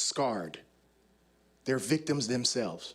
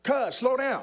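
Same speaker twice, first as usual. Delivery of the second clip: high loud call after a runner; gang member calling; 9 semitones higher, 3 dB louder, slower than usual. Retold or imitated. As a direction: shout after him to slow down, higher and louder. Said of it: "and he says".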